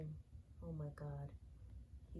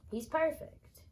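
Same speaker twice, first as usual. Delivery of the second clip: He's perfect high and loud, perfect rising; excited squeal; 11 semitones higher, 8 dB louder than usual. Real, not acted. Repeats praise, varied takes.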